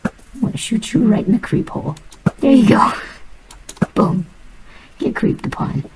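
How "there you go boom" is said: loud, high, victorious yell; a real burst of triumph; triumphant shout. Real, not acted. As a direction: shout it as the shot lands. Triumphant kill shot.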